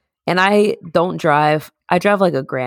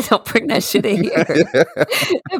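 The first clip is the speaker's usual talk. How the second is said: mock tough quote; 5 semitones higher, much slower than usual. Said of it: "quoting friend's tough line".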